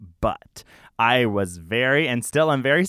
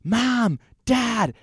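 retold scream; 10 semitones higher than usual. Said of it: "So I would scream".

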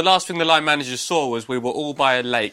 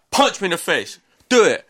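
raised-voice imitation of a reckless dare; higher, louder retold command; mock goading demand; 7 semitones higher, 5 dB louder than usual. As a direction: imitate a mate egging someone on, rising louder. Imitating friend demanding a punch.

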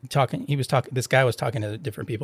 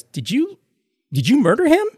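shocked question; 11 semitones higher, 3 dB louder than usual. Retold or imitated. Host's shocked reaction retold.

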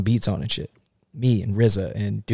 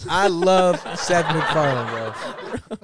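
laughing; 5 semitones higher, much slower than usual. Real, not acted. Gushing about his idol.

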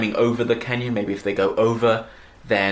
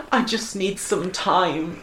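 slow, higher, mock dramatic reading; hammy line; 8 semitones higher, much slower than usual. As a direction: ham it up like a soap actor. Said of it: "hammy-ass dialogue".

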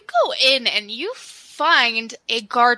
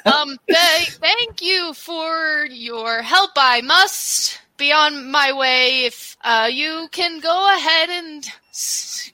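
hesitant, slowed, slightly raised voice; awkwardly grateful character; 4 semitones higher, slower than usual. Acted. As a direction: draw out polite thanks while edging away.

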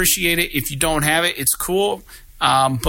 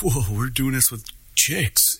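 Beavis and Butthead voice; 3 dB quieter, slower than usual. Retold or imitated. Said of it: "I'm like".

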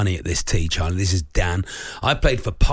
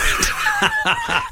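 high piercing electronic beep; shrill imitation of a chirping smoke alarm. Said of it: "That's very good".

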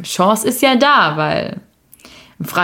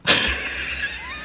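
shrieking laugh; 10 dB quieter than usual. Real, not acted. Loud laugh after skit.

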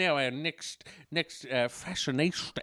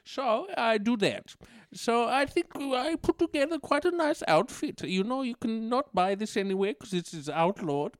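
put-on higher-pitched accented dictator, chatting about fashion; Mister Addy; 7 semitones higher than usual. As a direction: play a chirpy accented dictator showing off an outfit.

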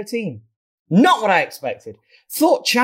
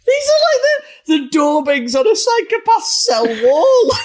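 high squeal while laughing; laughing shriek. Real, not acted. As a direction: shriek it out through giggles. Shrieking laughter at absurd review.

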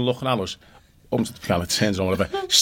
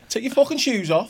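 high imitated command; high mock order to take your shoes off; 10 semitones higher than usual. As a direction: bark it in a squeaky mock voice.

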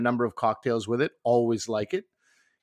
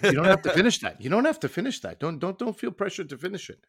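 higher, fussing voice of a caring friend; imitating worried friend; 7 semitones higher, faster than usual. Co-host mimicking the host's worried coaxing, higher and fussing. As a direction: mimic a mother-hen buddy fretting. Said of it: "being like".

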